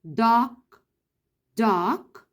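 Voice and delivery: doc said alone, clipped off as one syllable. Shown as a demonstration. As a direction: say doc on its own. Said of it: "فهتقولي له كده doc".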